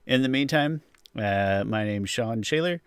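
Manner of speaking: normal talking voice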